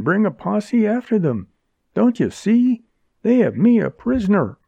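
put-on high, insistent young woman; pleading woman voice. A narrator's reading. Jerry's pleading speech, voiced female.

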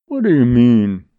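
loud challenge in a rising voice. A narrator's reading. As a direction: demand it loudly, voice rising. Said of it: "in a rising voice".

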